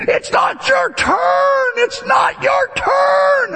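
high, shrill-free mock shout from the drive-thru; mock shout of a drive-thru worker; shouting lady. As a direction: yell it like an angry cashier. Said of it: "some lady in the back going".

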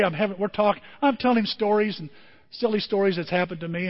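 usual speaking voice

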